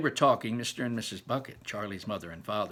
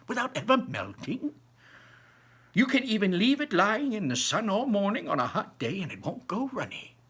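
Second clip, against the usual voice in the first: put-on high, lively old man voice; a grandpa bursting with wonder; old grandfather; 8 semitones higher, 4 dB louder than usual. Grandpa Joe dazzling Charlie with Wonka's inventions, high and lively.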